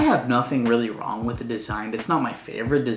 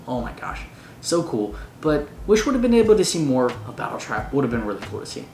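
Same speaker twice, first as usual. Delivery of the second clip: usual speaking voice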